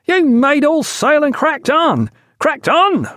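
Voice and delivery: slow, high, enraged shout; furious roar. A narrator's reading. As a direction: roar in rage, barely in control. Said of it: "roared the second".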